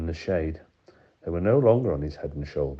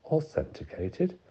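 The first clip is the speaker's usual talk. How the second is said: Authenticated? said slow with a high rising end; incredulous echo; 10 semitones higher, slower than usual. A narrator's reading. Lawyer throwing the warden's word back, slow and incredulous.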